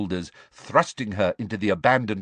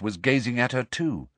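plain reading voice

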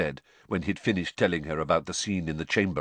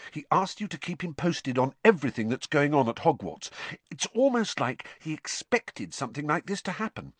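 Hermione voice; 8 semitones higher than usual. A narrator's reading.

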